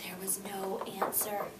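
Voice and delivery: whispered, soft. A narrator's reading. Teacher building suspense for the class, whispered. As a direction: hush it like a secret.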